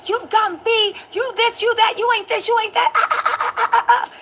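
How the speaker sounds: high-pitched mocking rant; high mocking berate; mock berating. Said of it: "then berated her".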